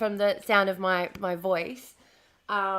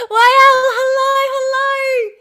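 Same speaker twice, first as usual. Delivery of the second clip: very high, loud, squealing hello; excited squeal; 12 dB louder, much slower than usual. Real, not acted. Streamer squealing hello to viewers, excited. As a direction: greet the chat with a thrilled squeal.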